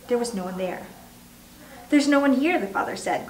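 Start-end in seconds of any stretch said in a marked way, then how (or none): none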